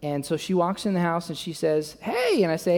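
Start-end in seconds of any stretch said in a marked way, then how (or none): 2.0-2.4 very high-pitched hello; imitating wife. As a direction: play the wife's high greeting. Voicing wife coming home.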